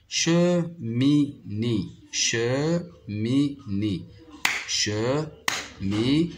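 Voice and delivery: "Cheminée" as che... mi... née, slowly; three drawn-out separate beats in "Cheminée": che, mi, née; clapped-out syllables. Shown as a demonstration. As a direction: say "Cheminée" one syllable at a time. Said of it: "on va frapper les syllabes".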